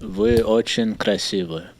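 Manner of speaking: speaking Russian. Shown as a demonstration. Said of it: "Can you speak some Russian to us?".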